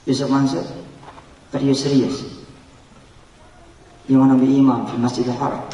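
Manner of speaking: a lightly voiced questioning inner self; the preacher voicing his own doubting inner self. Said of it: "saya mengatakan kepada diri saya".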